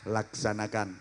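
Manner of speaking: low, soft, unhurried command; relaxed inspector reply. Shown as a demonstration. Headmaster inspecting the ceremony, low relaxed reply. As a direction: answer it calmly and quietly. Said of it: "Inspektur jawabnya".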